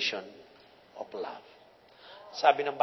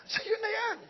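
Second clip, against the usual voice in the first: high laughing reply. Retold or imitated. Confirming gift, laughing.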